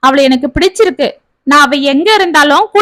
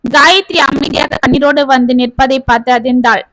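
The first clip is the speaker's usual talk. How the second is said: usual speaking voice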